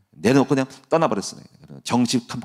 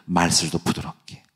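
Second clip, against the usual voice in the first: soft, lower example of gentle speech; quietly demonstrating softness; softly spoken; 4 semitones lower, 4 dB quieter than usual. Shown as a demonstration. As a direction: show how gently to speak.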